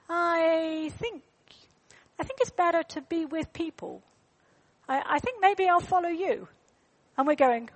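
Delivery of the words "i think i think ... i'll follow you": put-on higher, hesitant little boy voice; a hint of a small lost boy; lost boy's voice. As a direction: voice the unsure child. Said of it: "Then he goes".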